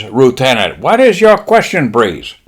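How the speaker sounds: mock-Japanese admiral, rising high mid-line. Admiral calling on lieutenant, mock-Japanese voice. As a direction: call on him in a Japanese accent. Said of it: "the admiral kinda looks up".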